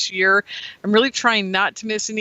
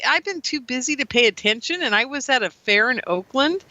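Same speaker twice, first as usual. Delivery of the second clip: ordinary talk, nothing marked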